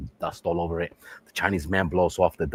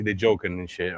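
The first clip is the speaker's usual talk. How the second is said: ordinary talk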